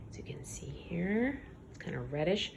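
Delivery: soft whisper pointing at the page. Real, not acted. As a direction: hush your voice to show.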